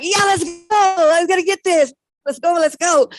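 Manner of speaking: high, loud, eager cheer; pumped up. Retold or imitated. Herself on the second morning, high loud eager cheer. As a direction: psych yourself up for the climb.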